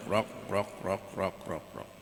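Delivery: low croaking rok rok, fading away; frog croaks. Retold or imitated. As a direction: croak like frogs sinking into a hole. Frog sounds in a story. (suara kodok menguak pelan)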